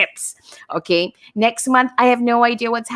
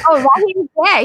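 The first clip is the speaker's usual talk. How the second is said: ordinary delivery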